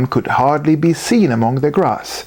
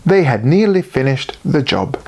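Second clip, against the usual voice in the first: ordinary delivery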